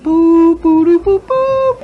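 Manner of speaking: high squeaky boops imitating a carnival tune; high, loud sing-song carnival boops; carny music toots. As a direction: toot it like a circus organ, high and loud. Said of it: "The music gets more carny-like".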